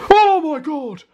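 startled high yelp; frightened cry. Real, not acted. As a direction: jump at the buzz. Bee buzzes at her.

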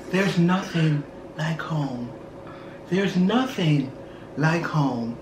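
low, slow movie-quote recital. Retold or imitated. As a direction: recite it slowly and softly, with pauses. Same quote voiced after whisper.

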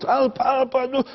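mild mock yell of a small boy; mock child's cry for help. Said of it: "Die kleine schreeuwt".

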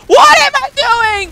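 high, loud frantic shout; shrieking at own blunder; panicked shout. Real, not acted. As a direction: yell at yourself in panic. Panicked in-game fight.

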